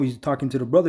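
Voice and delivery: normal talking voice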